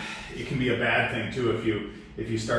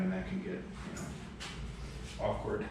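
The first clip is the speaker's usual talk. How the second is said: whispered; 12 dB quieter, slower than usual. Real, not acted. Embarrassed admission.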